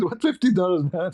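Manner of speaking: upset protest. Retold or imitated. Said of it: "I get upset".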